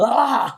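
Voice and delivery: loud, high imitated panicked "ah"; out-of-control yell. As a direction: mimic a crazy person's wild cry. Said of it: "like this, like, out of control".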